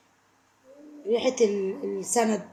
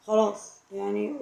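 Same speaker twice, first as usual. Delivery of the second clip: slow, softer, let it go with a sigh; resigned sigh; 3 semitones lower, 5 dB quieter than usual. Real, not acted. To viewers whose families feud, resigned and slow. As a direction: give up on it gently, slowly.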